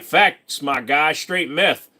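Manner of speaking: put-on a playful West Virginia accent for chat; mock West Virginia accent. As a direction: joke West Virginia voice, "meth" for math. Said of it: "If you go over to West Virginia".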